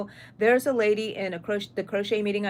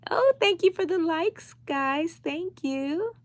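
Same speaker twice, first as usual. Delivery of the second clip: high, bright sing-song thank you; squealing thanks to the live-stream viewers; gushing high thanks; 9 semitones higher, slower than usual. Real, not acted. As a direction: gush over the likes in a high voice.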